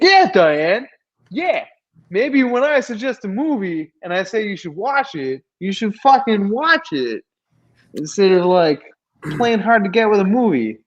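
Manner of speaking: high, pushy mock New York accent. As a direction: rant at Diane in a bad New York accent. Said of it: "in a fucking shitty New York accent".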